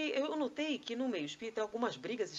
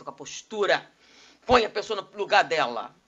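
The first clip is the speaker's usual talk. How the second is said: loud, forceful mock authority; imposing oneself; 11 dB louder than usual. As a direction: put someone firmly in their place. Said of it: "É dar um tapa moral".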